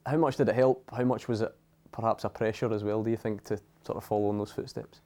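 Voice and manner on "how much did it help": ordinary delivery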